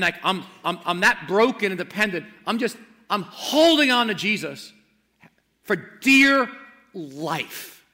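loud, urgent preaching, rising high on key words; fervent preaching. Acted. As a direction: preach it out with full conviction. Preaching at full force.

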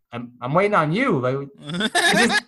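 loud, laughing mock complaint; exasperated retort. To the nurse asking if he's done, a loud half-laughing retort. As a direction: throw it back at her half laughing.

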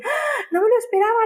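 high squeal of delighted surprise; imitating a thrilled customer. Customer squealing at the delivery driver, high and excited. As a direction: play the customer shrieking as the cake arrives. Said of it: "hay gente que grita cuando la recibe".

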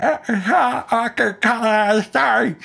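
high, garbled words with the jaw wedged open; mouth stretched open. Acted. Dental patient protesting a story, mouth stretched open. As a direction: talk without closing your mouth.

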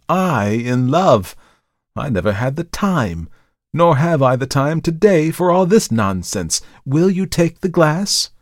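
high, indignant rebuff; scoff, then press for the sale; indignant dealer. Acted.

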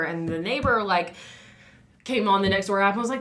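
normal talking voice

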